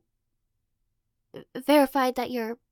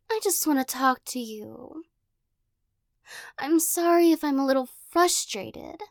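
teasing possessive captor. Acted. Captor pinning the listener against a wall, playful menace. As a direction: toy with them like a cat with a mouse.

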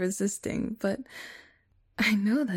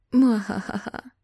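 mad scientist; 3 semitones higher, 5 dB louder than usual. Acted. Villainous cuddle trap gloat.

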